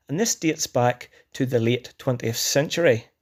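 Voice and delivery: normal talking voice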